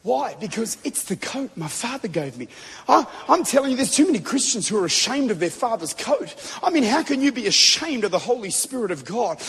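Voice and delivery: ordinary talk, nothing marked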